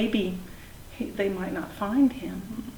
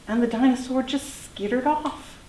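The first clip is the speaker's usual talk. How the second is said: ordinary delivery, nothing marked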